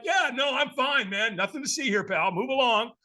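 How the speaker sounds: high-pitched, fake "I'm fine"; overly casual mock denial; lying reassurance. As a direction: brush them off with an obvious cover-up. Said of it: "I lie like everybody else".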